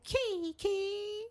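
high squeaky "Kiki"; a hint of a camp woman; man doing a woman's voice. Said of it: "when he was like".

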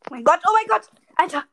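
loud, high astonished outburst; shocked exclamation. Real, not acted. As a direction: react in real disbelief. Surprise at explosion.

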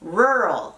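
"Rural" as one gliding RUR-ul, curled R; careful isolated "Rural"; English pronunciation. Shown as a demonstration. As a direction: model "Rural" slowly with American R. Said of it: "muy difícil de pronunciar".

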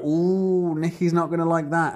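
high, mock onlooker "ooh"; lightly hammed-up ringside warning; gossipy anticipation. Coworkers anticipating his reaction.

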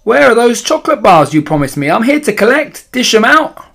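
put-on high, loud, greedy child; demanding boy outside. A narrator's reading. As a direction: shout through the door like a pushy small boy. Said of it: "came the boy's voice from outside".

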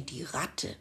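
usual speaking voice

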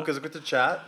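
ordinary delivery

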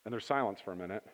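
hushed storyteller after the shout; storytelling hush. A narrator's reading. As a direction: draw them in quietly. Quiet storytelling after the climax.

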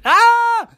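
very high, loud startled scream; monsters' scream. A narrator's reading. Monsters seeing the tiny person at the door, startled scream. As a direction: shriek like frightened monsters.

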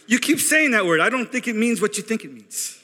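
put-on high-pitched Spanish-accented movie quote. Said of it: "I could do the Spanish ones".

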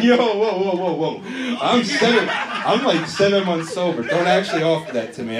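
loud, high, hurried backpedalling; mock alarm. Comic stopping someone offering drugs, loud mock alarm. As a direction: call a halt in comic panic.

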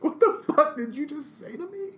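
high, slow, outraged disbelief; shocked outrage. Retold or imitated. Retelling his reply to the coworker, high shocked outrage.

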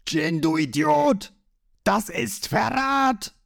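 high-pitched, drawn-out outraged yell; shouting. A narrator's reading. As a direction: cry it out high, stretching each word. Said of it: "Creek schreit los".

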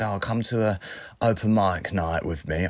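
ordinary talk

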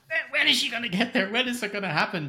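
high, strained, impatient asking; anxious viewer. Retold or imitated. Viewer pleading at the screen, high and anxious. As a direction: beg the film to hurry up. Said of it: "It's like".

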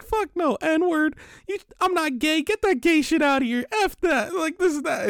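high, loud imitated kid voice; kid yelling. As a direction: shout it back like an offended kid. Kid refusing, voiced in the story.

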